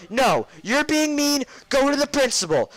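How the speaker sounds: hammed-up high-pitched order; scolding teacher. Art teacher sending kid to the principal, mock scolding screech.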